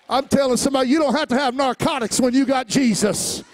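high, loud shouted preaching; rising fiery sermon shout; preaching at full force. Acted. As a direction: preach it out to the whole church. Closing exhortation shout.

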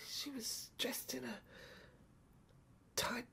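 breathy hushed whisper; whispering in awe. A narrator's reading. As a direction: lean in and whisper it. Hushed description of the woman.

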